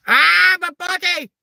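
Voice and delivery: very high, loud mock scream. Imitating ex panicking in the bubble bath, screaming. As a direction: shriek it like a hammed-up victim. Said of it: "he starts screaming".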